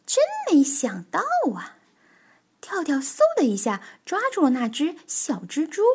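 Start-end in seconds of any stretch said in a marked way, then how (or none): 0.0-1.8 high-pitched astonished exclamation; very surprised. Acted. Tiaotiao sees a friend so scared, very surprised. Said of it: "跳跳好意外啊".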